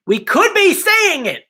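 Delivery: high, slow hopeful emphasis; excited. Real, not acted. Hyped repeat of crab hope.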